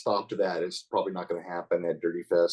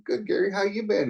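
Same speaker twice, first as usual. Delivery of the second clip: wry upbeat reply; 11 semitones higher than usual. Retold or imitated. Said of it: "I kinda looked at him and said".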